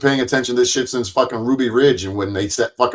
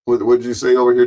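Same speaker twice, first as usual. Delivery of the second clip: ordinary talk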